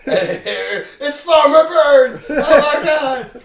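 very high, loud, slow, laughing call; jokey dramatic ringside announcement; mock announcer reveal. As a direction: hype it like a crowd gasp.

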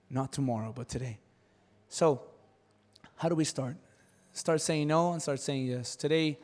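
ordinary talk

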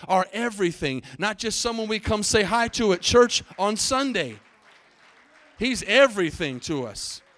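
loud, rising preacher's shout; loud, impassioned preaching; preaching at full force. Real, not acted. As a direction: drive it home to the whole church. Preaching climax on "everything.

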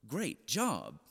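unimpressed parent's muted echo; disbelieving echo. Retold or imitated. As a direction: echo the praise as if you can't believe it. Disbelieving parent echoes coach's praise.

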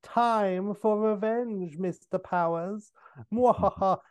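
high, slow villain voice with a cackle; Doctor Evil. Retold or imitated. Intruder gloating over Mister Powers, hammed-up evil villain voice. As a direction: gloat like a triumphant supervillain. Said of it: "the intruder laughs in triumph and says".

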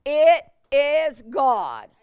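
slow, loud, weighty declaration. Real, not acted. Pastor declaring to the church slowly and loudly. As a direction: land each word slowly.

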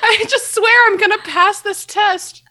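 high, slow, giggly nervous voice; nervous giggle. Pretend. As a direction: laugh nervously through it. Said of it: "What do people get nervous about?".

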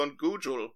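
expert tells host it's findable, "Google" mispronounced as "GOO-jul"; mispronouncing Google. Acted. Expert advising the host on searching, mispronounced. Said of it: "Do you mean Google?".

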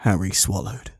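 ordinary delivery, nothing marked

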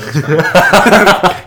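slow, drawn-out laughing sarcasm; mock sarcastic. Joking about his partner, slow sarcastic laugh. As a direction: drag it out as a joke, laughing.

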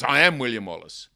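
proclaiming. Retold or imitated. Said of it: "He says".

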